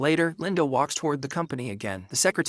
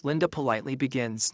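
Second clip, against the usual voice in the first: usual speaking voice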